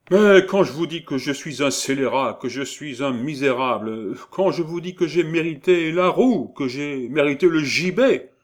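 loud, high, insistent outburst; vehement self-accusation. A narrator's reading. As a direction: hammer it out in exasperation. Scholar vehemently accuses himself to jailer. (véhément, exaspéré)

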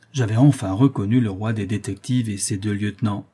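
ordinary delivery, nothing marked

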